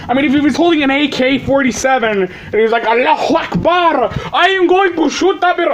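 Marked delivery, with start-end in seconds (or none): 2.9-5.7 high-pitched mock Arabic accent. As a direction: act a hammed-up gunman with an Arabic accent. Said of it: "doing that in an Arabic accent".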